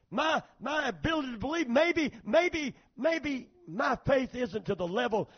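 high, loud sermon delivery; impassioned testimony from the pulpit; fired-up preaching. Real, not acted. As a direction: preach it hard, punching key words. Preaches to congregation at full force.

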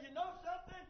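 soft, hushed confession; a quiet, contrite apology to a friend; soft humble apology. Shown as a demonstration. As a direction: say sorry softly and humbly.

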